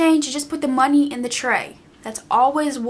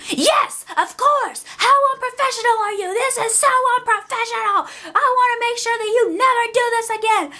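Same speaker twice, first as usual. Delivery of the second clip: mock snooty lady, much higher and fast; scolding customer; 10 semitones higher than usual. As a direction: lecture the worker in a high, huffy put-on voice. Rude customer scolding, voiced in story.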